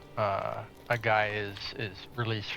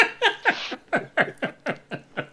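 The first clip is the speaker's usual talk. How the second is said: high burst of laughter; 5 dB louder than usual. Real, not acted. Reacting to a joke.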